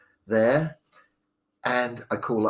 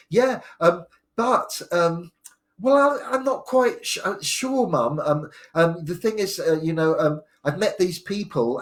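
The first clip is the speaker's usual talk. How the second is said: much higher, hesitant and halting; nervous stammer; 8 semitones higher, 7 dB louder than usual. Retold or imitated. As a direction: stumble through a confession to mum. Nervous son confessing to mum.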